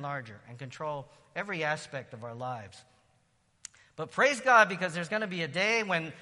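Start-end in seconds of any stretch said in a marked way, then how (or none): 3.9-6.1 loud, high, fervent praise; preaching at full force. Real, not acted. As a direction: lift the church with a shout of praise.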